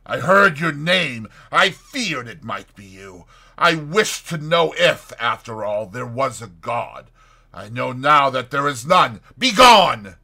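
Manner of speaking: loud accusation building to a shout; bitter denunciation. A narrator's reading. Fetz condemns and banishes the doctor, loud and bitter. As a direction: denounce him, then bellow the dismissal.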